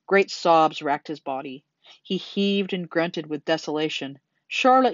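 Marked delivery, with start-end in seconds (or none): 4.5-4.9 high, loud wail of a name; anguished moan. A narrator's reading. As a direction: call out in grief. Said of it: "he moaned".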